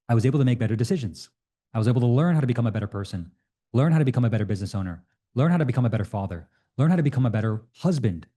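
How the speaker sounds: rapid, rising conviction; fast build-up. Real, not acted.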